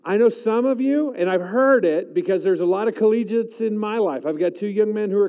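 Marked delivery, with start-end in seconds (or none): none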